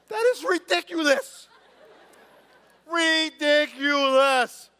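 high, loud, drawn-out outcry; loud mock disbelief. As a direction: belt it out, stretching every syllable. Scoffs at the written worry.

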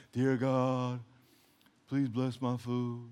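low, slow, droning prayer; mock boring prayer. Believer praying over food to a bored God, droning. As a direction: drone it softly, mocking rote grace. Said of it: "very bored with our prayers".

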